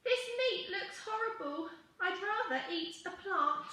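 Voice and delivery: high little dinosaur voice refusing food; squeamish young dinosaur. A narrator's reading. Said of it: "And he said".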